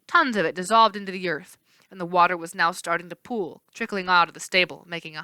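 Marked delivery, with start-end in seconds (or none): none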